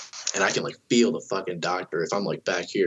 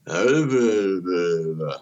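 imitated woozy babble; much slower than usual. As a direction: babble like someone passing out. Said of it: "And she goes".

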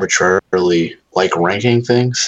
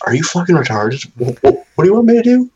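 high, louder incredulous outburst; mock exasperation; 9 semitones higher, 4 dB louder than usual. Students imagined scoffing at him, high exasperated mockery. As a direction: play the baffled student who thinks he's an idiot.

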